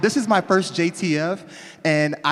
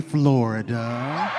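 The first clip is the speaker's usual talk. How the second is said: ordinary talk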